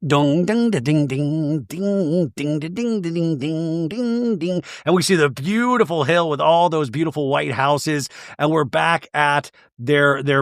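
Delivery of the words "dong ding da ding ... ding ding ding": sung ding-ding plucked tune; imitating Greek guitar. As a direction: hum a twangy bouzouki riff. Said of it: "like Greek guitar music".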